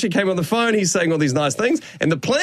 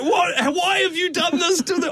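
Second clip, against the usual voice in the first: much higher, mock-outraged question through a laugh; outraged family; 12 semitones higher than usual. Family members calling in, imitated in a high outraged voice. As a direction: play the baffled relatives, half-laughing. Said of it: "being like".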